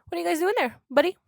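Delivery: loud call across the dark room. Retold or imitated. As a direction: call out to the parents, loud and high. Calling out to parents at night.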